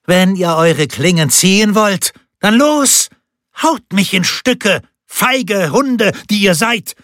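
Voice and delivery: high, defiant taunt, daring them to strike; scornful, shrill dare thrown at the swordsmen; furious challenge. A narrator's reading. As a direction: Picarda taunts the men as cowardly dogs, high and furious. Picarda dares the men to strike.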